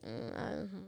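very soft, noncommittal murmur, acting unsure; acted reluctance. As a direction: voice someone hesitant and unenthusiastic. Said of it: "even if you're like".

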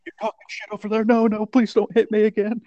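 hammed-up beaten man begging not to be hit; battered Birdman. Birdman quoted by friend, high frantic mock pleading. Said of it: "Birdman comes back all busted up".

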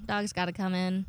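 ordinary talk, nothing marked

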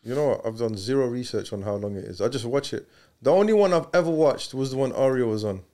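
ordinary talk

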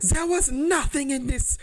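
high, indignant mock rant in a vaguely Russian accent; yelling in accent. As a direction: imitate an outraged foreign passenger yelling at staff. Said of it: "she was, like, yelling".

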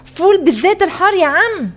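put-on high, proud child's call; triumphant. A narrator's reading. Boy repeats his order at the counter, triumphant. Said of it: "وكررت بانتصار".

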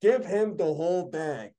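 high, slow emphatic begging; slow pleading. Real, not acted. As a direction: drag out each demand. Begging studio to pay Finn.

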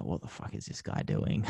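low, hushed, half-whispered aside; baffled inner thought. Retold or imitated. Host on live TV baffled by a staring guest, hushed muttered thought. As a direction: mutter it under your breath in disbelief.

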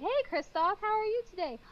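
sweet texting voice. Retold or imitated.

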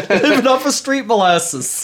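laughing through the words; giggling as you repeat the joke; laughing at the silly phrase. Real, not acted.

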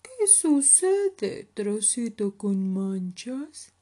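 a big bear asking the little one what's up; drawn-out, loud storybook bear; bear character. Acted. Oso voices concern to cub.

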